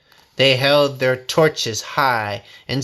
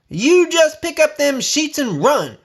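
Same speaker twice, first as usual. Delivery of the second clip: much higher, louder hollering; 13 semitones higher, 4 dB louder than usual. A narrator's reading. Bessie hollers at the riders to run, loud and high. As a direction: holler them off the land. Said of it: "she hollered".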